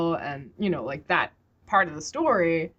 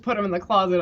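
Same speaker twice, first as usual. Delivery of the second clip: ordinary talk, nothing marked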